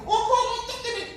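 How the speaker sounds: very high squeaky mock voice; would-be jumper, mimicked. Someone about to jump off a building, mimicked in falsetto.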